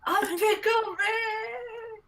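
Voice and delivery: very high, slow shrieking cartoon yell; Pickle Rick. Quoting Pickle Rick meme.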